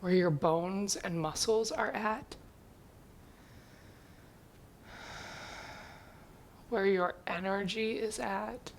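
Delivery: slow, softening meditation voice with long pauses; guided meditation. Acted. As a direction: ease them gradually into stillness, slowly and quietly. Leads a seated check-in.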